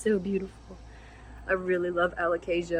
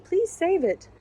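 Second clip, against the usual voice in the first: high, louder mock plea from the seller; imitating a pleading seller, higher and louder; pleading quote; 11 semitones higher, 4 dB louder, slower than usual. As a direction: beg her to rescue the plant, pitched way up. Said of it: "the person was like".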